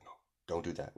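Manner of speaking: soft, low caution; quiet warning. Acted. Kagura warning her rival, quietly. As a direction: warn them under your breath. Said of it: "you know, that warning look".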